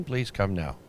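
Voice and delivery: ordinary talk, nothing marked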